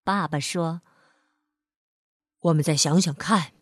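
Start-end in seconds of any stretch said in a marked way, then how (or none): 2.4-3.5 lower-pitched fatherly voice; calming father. Acted. Father responds to his crying son, calming. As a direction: reassure in a low, brisk tone. Father calms child, low voice.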